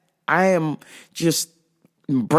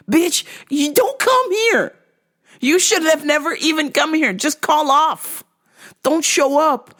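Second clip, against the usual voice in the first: exasperated rant; 9 semitones higher than usual. Retold or imitated. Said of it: "I'm like".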